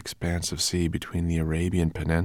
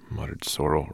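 ordinary delivery

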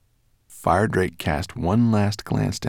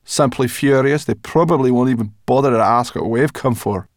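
a touch higher, fretful voice; nervous brownie girl; 5 semitones higher, 8 dB louder than usual. Acted. Brownie girl fretting about the angry dragons, nervous. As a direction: worry it out loud. Sorrel worries.

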